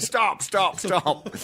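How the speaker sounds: high-pitched imitation of someone calling a halt; mock urgent halt. Director halting a terrible audition, retold in mock alarm. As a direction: cut in like an exasperated director, playfully.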